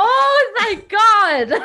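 loud, high relieved cry; relieved exclaim. Real, not acted. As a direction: gasp it out in relief. Relief at a light book.

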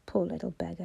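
put-on low, gruff old man's murmur; gruff sympathy. A narrator's reading. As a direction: grumble it low, with grudging pity. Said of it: "he said gruffly".

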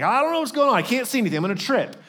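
high-pitched flustered outburst, easing down at the end; mock panic. Preacher acting out someone who can't see, mock panic. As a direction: play someone suddenly blinded and flustered.

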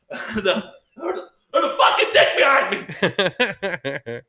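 high-pitched imitation of yelling; retold yell. Imitating woman panicking on a work call, yelling. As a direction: stammer into a high mock scream. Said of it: "because she just yelled".

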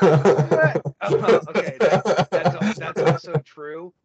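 laughing loud and high through the words; loud surprised laughter. Real, not acted. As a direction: caught off guard, cracking up. Laughing at the host's joke.

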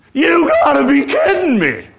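high, loud mocking "You gotta be kidding me"; scornful disbelief. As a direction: scoff at the request from way up high. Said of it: "Nabal's response basically is".

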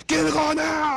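very high, imitated yell down the phone; retold shouted order. Caller repeats his demand to a confused friend, high shouted order. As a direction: shout the order, cutting off any questions.

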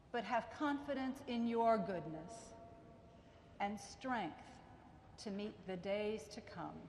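ordinary delivery

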